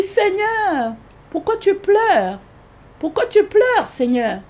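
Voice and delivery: high, pleading plea to the Lord; pleading question. Retold or imitated. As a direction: ask him high and pleading why he weeps. She pleads with the weeping Lord.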